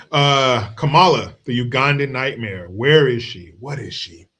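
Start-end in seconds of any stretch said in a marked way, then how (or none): none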